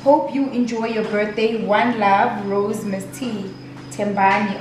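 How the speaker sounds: ordinary delivery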